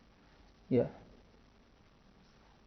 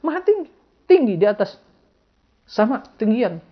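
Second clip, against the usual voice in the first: the slave answering high and sure, without doubt; confident answer; 8 semitones higher than usual. Acted. Preacher voicing the slave girl's certain reply, raised and sure. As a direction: proclaim it with no hesitation. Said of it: "dia menjawab tanpa keraguan".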